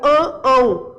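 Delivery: "an, ão" honked through the nose; nasal "fanho" sounds. Shown as a demonstration. Teacher's memory trick about the nasal gym-goer, "an, ão" said nasally. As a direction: exaggerate the nasal vowels in "an, ão". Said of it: "o fanho da academia".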